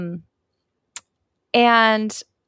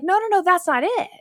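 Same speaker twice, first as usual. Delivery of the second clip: higher, earnest no-no-no; mild retold insistence; insistent correction; 10 semitones higher than usual. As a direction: stop her politely but firmly. Woman insists, retold quote.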